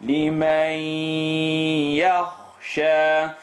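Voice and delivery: لِمَنْ يَخْشَى with a held nasal on the merged ya; nasal merge. Shown as a demonstration. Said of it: "make a nasaling sound".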